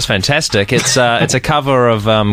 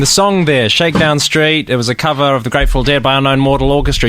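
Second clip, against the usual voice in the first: radio announcer; 5 semitones higher than usual. Acted. Radio host link.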